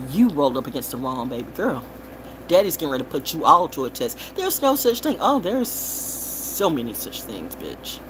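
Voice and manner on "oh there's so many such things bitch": slow, low, soft threat; menacing taunt. Real, not acted. Warning enemies who came at her. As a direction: drop it down quiet and menacing.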